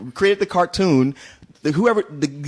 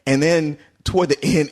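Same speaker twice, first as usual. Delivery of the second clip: ordinary talk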